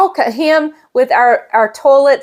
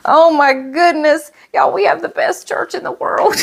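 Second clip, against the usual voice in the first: overcome; 6 semitones higher than usual. Real, not acted. Overcome by the gift.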